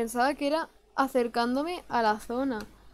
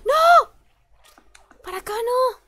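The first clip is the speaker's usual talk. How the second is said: a much higher, much louder panicked cry; panicked shout; 13 semitones higher, 12 dB louder than usual. Real, not acted. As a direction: yell at the game in alarm. Panics as character goes wrong way. (grito de pánico muy agudo y fuerte)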